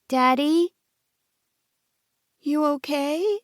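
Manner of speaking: put-on high, slow concerned child; worried little girl. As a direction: ask gently like a child.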